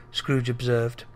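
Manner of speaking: ordinary delivery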